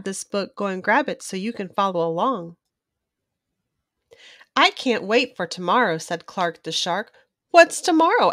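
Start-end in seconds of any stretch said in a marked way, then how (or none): 4.6-7.1 lively storybook read-aloud; reading to a child. A narrator's reading. Children's book narrator reading aloud to young viewers. 7.5-8.3 a higher, louder Benny the Blowfish; lightly voiced blowfish. Benny the Blowfish asks Clark, lightly voiced higher.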